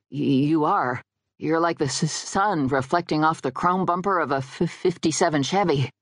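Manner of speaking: halting on first consonants; stammering suitor. Acted. Dead guy flatters a woman, stammering. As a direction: stammer out an awkward compliment. Nervous ghost stammers out a compliment.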